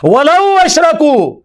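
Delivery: high, slow Quran recitation; ringing recitation. Acted. Preacher quotes the verse on the prophets, high slow recitation. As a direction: recite it high, holding the final vowel.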